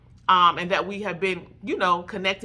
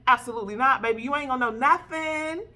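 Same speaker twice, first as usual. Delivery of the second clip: higher, teasing put-down; sassy; 5 semitones higher than usual. Acted. Woman telling the man he'll learn nothing, teasing and sassy. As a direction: toss it off with sass.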